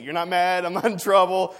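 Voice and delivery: gleeful, carefree brother impression; delighted relief. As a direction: imitate a kid thrilled to be off the hook.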